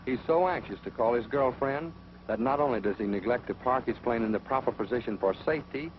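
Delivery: ordinary talk, nothing marked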